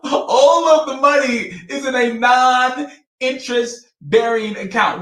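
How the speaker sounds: high-pitched, incredulous laughing voice. Real, not acted. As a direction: say it through real laughter, pitched well up. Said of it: "why am I laughing?".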